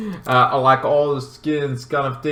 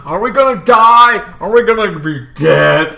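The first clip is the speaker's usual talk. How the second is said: loud, alarmed questioning; mock dread; 5 semitones higher, 6 dB louder than usual. Siblings chased, asking about death, loud mock dread. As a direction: cry it out in exaggerated fear.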